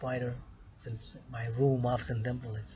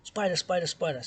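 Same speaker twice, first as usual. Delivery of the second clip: panicked yell; 5 semitones higher, 5 dB louder than usual. Retold or imitated. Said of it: "Runs to the door".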